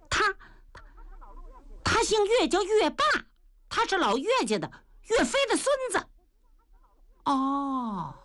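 put-on high, quick, chattering old man voice; blind old Taoist. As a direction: play a fussy half-blind old Taoist. (尖声絮叨的瞎眼老道)

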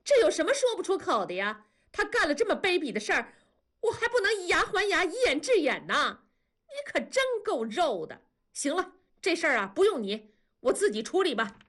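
shrill up high and rattle it off at her husband; 声音高了八度，又快又急. Acted. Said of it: "文丽的声音高了八度说".

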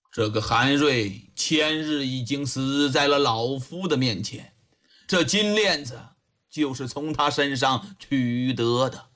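low, grave magistrate voice; low and solemn old investigator; grave old official. A narrator's reading. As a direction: break the news in a deep, measured voice.

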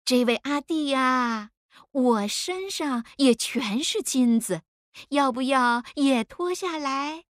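put-on slow, high, coaxing Dongxing accent; soft girlish Dongxing accent. A narrator's reading. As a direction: draw it out coyly in a Dongxing accent. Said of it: "用软妹的东兴口音说". (软妹的东兴口音，慢悠悠地说)

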